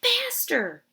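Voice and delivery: high, loud yell to run; urgent shout. Acted. Kids fleeing the man.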